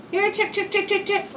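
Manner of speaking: high sing-song chick chick call; calling chickens. Real, not acted.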